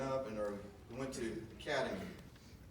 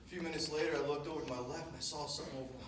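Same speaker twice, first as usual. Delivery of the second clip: normal talking voice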